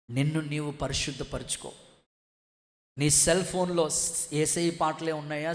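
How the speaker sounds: ordinary talk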